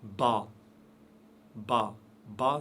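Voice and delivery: ba said heavy, the wrong way; "ba" with a dark, backed vowel. Shown as a demonstration. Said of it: "On ne dit pas".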